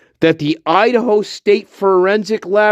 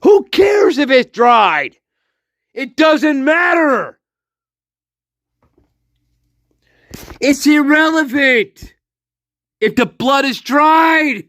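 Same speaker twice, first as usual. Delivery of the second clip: real frustrated shouting; angry shouting; 6 semitones higher than usual. Real, not acted. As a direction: yell it at the fools. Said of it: "I'm sorry for shouting".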